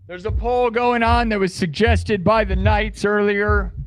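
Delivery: usual speaking voice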